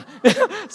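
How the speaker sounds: high, loud laugh; preacher bursting into laughter mid-sermon. Real, not acted. Laughs at himself.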